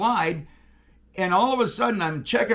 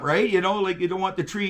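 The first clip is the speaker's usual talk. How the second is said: ordinary talk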